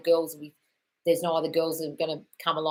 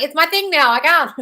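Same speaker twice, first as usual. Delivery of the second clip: higher, louder playful protest; mock defiant; 9 semitones higher, 9 dB louder than usual. Answering her brother about swearing, mock defiance. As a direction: toss it back cheekily. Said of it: "I was like".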